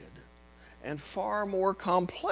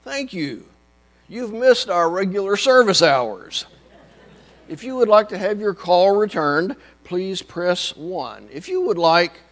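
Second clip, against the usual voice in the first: phone menu voice; 2 semitones higher, 10 dB louder than usual. Retold or imitated. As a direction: drone through the menu options. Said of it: "It went something like this".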